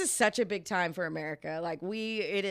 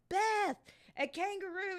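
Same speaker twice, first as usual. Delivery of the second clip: high, hushed mock call across the way; retold excited call, pitched up but soft; calling a friend excitedly; 10 semitones higher, 5 dB quieter than usual. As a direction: imitate calling a friend over to see.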